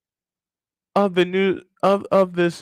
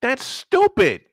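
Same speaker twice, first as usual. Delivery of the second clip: high, drawn-out scorn; exasperated verdict; 8 semitones higher, slower than usual. Real, not acted. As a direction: stretch it slowly in high-pitched disgust. Verdict on the stadium plan.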